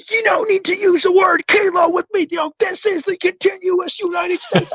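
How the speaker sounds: high, shouted mock controller rant; mock angry controller. Controller scolding pilot over the radio, high mock shout.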